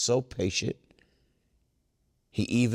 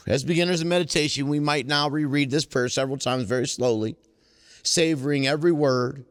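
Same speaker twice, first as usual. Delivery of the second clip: reading aloud; 4 semitones higher, 4 dB louder than usual. Acted. Reading aloud from the book to the meeting. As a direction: read the book passage to the group. Reads passage again.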